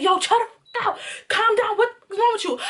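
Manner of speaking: imitated alarmed boyfriend. Imitating boyfriend grabbing her foot.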